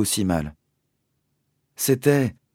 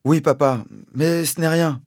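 put-on much higher, childlike voice; small boy voice; 9 semitones higher, 4 dB louder than usual. A narrator's reading. Danny telling his father it is nothing, in a child's higher voice. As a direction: play it down like a scared boy.

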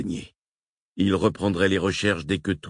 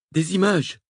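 Francesca hurle dans le micro, voix plus aiguë; cri; 12 semitones higher, 3 dB louder than usual. A narrator's reading. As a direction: lancer le mot en criant. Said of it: "hurla Francesca Sabatini". (Francesca crie à Wakefield pour réclamer des images)

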